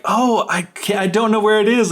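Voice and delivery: high-pitched, acted cluelessness; feigned innocence. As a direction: play dumb as you pretend to search. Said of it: "pretending like".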